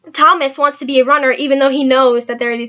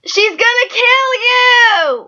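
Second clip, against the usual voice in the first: high, slow, wailing shout; crying shout; 11 semitones higher, 4 dB louder, much slower than usual. Acted. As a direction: sob and yell the warning. Sibling fight over mom's turnover.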